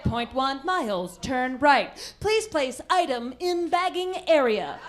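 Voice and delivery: slow, robotic automated-lady voice; stilted American navigation announcer; American Jill. Retold or imitated. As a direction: speak word by word like a self-checkout machine. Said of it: "Mine's called American Jill".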